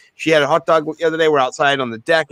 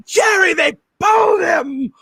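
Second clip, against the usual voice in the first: drawn-out, much higher, slow mock cry; mock disbelief; 14 semitones higher, slower than usual. Co-host echoes the pitcher news in slow, hammed-up disbelief. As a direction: echo it back, stretching it out in theatrical dismay.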